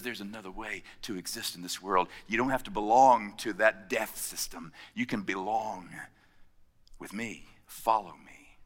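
low, soft and tender; quiet, tender call to follow; gentle Jesus. Acted. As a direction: draw him in softly, an invitation. Jesus voiced gently to Matthew.

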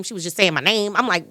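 usual speaking voice

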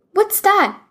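put-on high, alarmed little-boy question; high startled child's question; boy asking. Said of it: "he asked the dragon".

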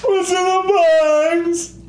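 high, drawn-out hammed-up howl; mock anguished scream. Co-host joining the box joke, mock screaming. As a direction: scream it slowly in mock agony.

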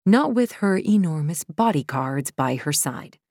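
ordinary delivery

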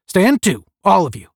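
loud, high military order; barked command. A narrator's reading. Captain walks in on three cadets, barking a command. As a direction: call the room sharply to attention.

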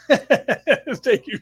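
laugh, then thank them through it. Real, not acted. Host thanks a listener for reassurance, laughing.

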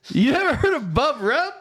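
high, incredulous question; can't believe you missed it; surprised disbelief. Real, not acted. Disbelief at friend's ignorance.

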